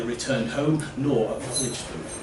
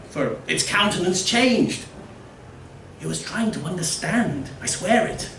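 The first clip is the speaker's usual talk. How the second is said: earnest villager; 7 semitones higher than usual. Acted.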